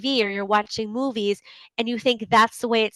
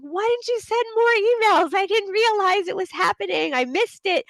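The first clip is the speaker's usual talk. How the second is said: mock complaint; 9 semitones higher than usual. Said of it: "sometimes people are like".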